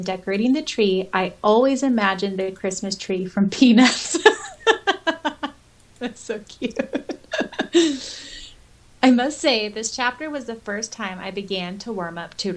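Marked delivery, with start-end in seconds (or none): 4.2-5.5 bright high laugh; high giggle. Real, not acted. Laughing at Peanuts tree image. 6.8-8.5 breathy giggle. Real, not acted. Still laughing after "so cute.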